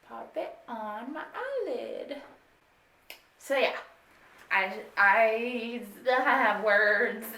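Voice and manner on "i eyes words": loud laughter through a stumble; giggling over tangled words; laughing at flub. Real, not acted. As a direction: crack up mid-sentence.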